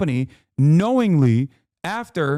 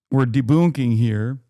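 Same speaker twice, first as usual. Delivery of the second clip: mock deep announcer; 5 semitones lower, 4 dB louder than usual.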